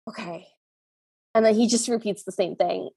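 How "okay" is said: a soft, resigned okay after a sigh; a reluctant uncle sighs and gives in, hushed. Retold or imitated. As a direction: give in quietly, as if cornered. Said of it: "looks around and sighs and goes".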